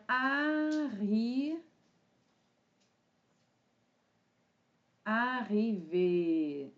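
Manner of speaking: "arriver" stretched slowly, syllable by syllable; slow sounding-out of "arriver"; drawn-out dictation. Shown as a demonstration. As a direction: draw out each vowel of "arriver" as if writing. Drawing out word while writing it.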